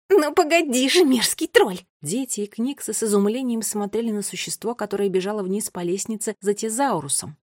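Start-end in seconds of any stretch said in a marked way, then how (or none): 0.1-1.8 high, loud furious threat; angry threat. A narrator's reading. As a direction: shout after someone you are chasing. Furious pursuer threatens the troll. (громкая гневная угроза)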